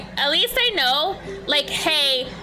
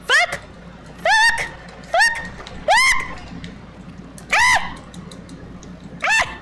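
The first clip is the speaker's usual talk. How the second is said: short, high-pitched startled cries; real high-pitched alarm; panicked yelps; slower than usual. Real, not acted. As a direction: yelp curses in a sudden panic. Panicked swearing during game.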